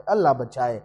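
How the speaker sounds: soft, low murmured prayer for protection; hushed plea. Acted. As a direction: breathe it as a frightened prayer. Repeated plea.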